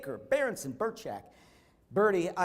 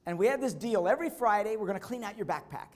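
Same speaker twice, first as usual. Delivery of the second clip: a lightly voiced mom, higher and louder; voicing the mother; 4 semitones higher, 5 dB louder than usual. Mother telling Doctor Berg about her daughter, lightly voiced, higher and louder. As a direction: pitch her up as the eager mom telling the story. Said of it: "And she said".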